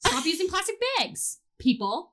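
high, ranting mock outrage. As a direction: rant at the world, half joking. Voicing her own angry tweets.

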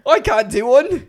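high, loud, drawn-out attempt at Irish; bad Irish accent. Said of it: "Your Irish accent".

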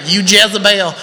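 mock Southern accent. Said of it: "hear it in a southern accent".

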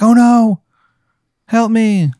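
high, loud cry for help; mock panicked cry. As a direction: ham up a fading ghost's panic. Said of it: "He's like".

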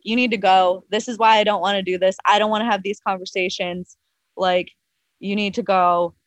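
ordinary delivery

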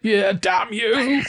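slow, high, bellowing pirate curse; drawn-out pirate yell, pitched high; pirate shout. Acted. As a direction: roar it like a pirate. Pirate voice in book retelling.

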